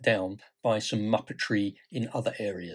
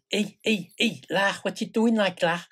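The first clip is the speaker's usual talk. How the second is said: hammed-up sing-song Scouse, much higher; Scouse accent; 9 semitones higher than usual. Grandad reading to grandkids, in a comic sing-song Scouse accent. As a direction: lilt it like a comic Liverpudlian. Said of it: "get up to the tune".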